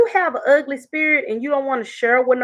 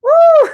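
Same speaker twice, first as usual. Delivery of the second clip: loud high whoop of laughter; 8 dB louder than usual. Real, not acted. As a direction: let out a big laughing woo. Laughing at own anger.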